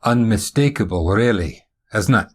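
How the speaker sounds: Scottish accent with put-on cheer; mildly forced Scottish heartiness; forced enthusiasm. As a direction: answer a tired old question with dutiful brightness. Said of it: "forced enthusiasm of someone who has answered this".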